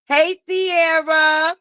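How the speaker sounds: usual speaking voice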